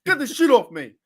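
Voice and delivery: hammed-up coach outburst; mock yell. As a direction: shout it the second time, fed up. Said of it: "And he's like".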